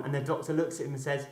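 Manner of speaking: usual speaking voice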